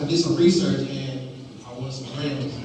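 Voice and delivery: usual speaking voice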